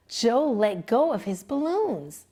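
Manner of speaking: ordinary delivery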